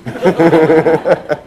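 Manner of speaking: big loud laugh. Real, not acted. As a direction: burst out laughing. Laughing after Dude.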